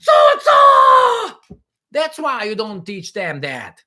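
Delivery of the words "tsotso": high, loud hammed-up scared child; mock terror. Children scaring each other with a story, hammed-up fright. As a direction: build from hushed-word alarm to a long, high mock scream. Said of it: "not afraid".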